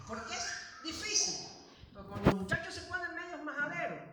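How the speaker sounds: fiery sermon delivery; preaching at full force. Acted. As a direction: drive it home from the pulpit.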